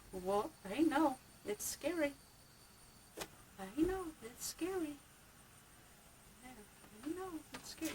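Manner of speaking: high, soft cooing. Real, not acted. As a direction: comfort a frightened bunny gently.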